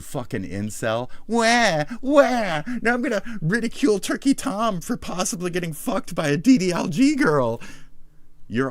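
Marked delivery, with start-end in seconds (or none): 1.3-2.6 high, loud fake baby wail; jeering "wah-wah" cry; mock crying. Mocks the jealous man crying. 2.8-7.6 high, whiny put-on voice; mock whiner. Voices the whining jealous rival.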